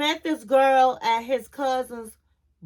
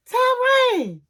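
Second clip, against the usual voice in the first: name cried out much higher, exasperated; exasperated cry; 12 semitones higher, 4 dB louder than usual. Acted. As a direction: call his name out in high disbelief. Exasperated reaction to his answer.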